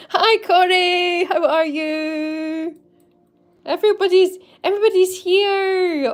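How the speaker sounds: high, loud, sing-song hello; welcoming viewers as they arrive, high sing-song joy; delighted greeting. Real, not acted.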